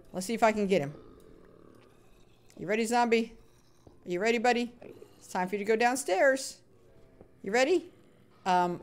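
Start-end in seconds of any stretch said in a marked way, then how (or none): none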